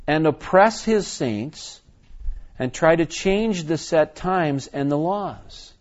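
slow, weighty reading of prophecy; scripture reading. Acted. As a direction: read each phrase out emphatically, with deliberate pauses.

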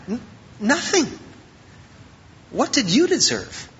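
usual speaking voice

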